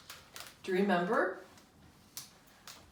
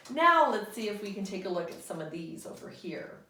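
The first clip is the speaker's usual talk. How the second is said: usual speaking voice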